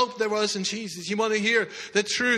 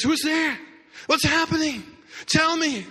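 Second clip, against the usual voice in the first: calling out; 6 semitones higher than usual. Acted. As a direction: shout into the crowd. Blind beggar calling out.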